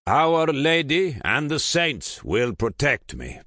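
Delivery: firm, high, loud declaration. A narrator's reading. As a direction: declare it with full conviction. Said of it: "declared firmly".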